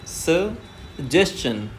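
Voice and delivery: Suggestion drawn out very slowly; extremely slow teaching model. Shown as a demonstration. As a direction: stretch suggestion out, syllable by syllable. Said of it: "slow पढ़ना है".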